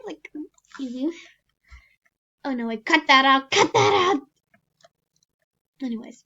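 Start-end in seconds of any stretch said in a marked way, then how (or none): 2.4-4.2 loud yelled cut that out; panicked shout. Real, not acted. Panicked retake call.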